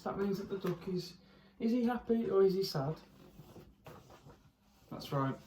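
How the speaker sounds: ordinary delivery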